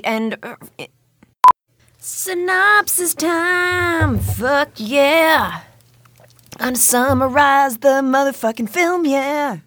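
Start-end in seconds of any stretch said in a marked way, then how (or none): 2.0-9.6 hyped announcer. Pretend. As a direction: pump it up like an announcer.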